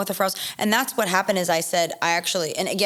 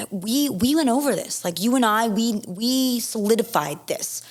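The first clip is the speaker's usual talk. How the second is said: indignant planner; 6 semitones higher, slower than usual. Retold or imitated. Planner protesting in retold argument.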